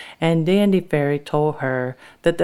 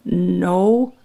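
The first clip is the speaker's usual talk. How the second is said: plain reading voice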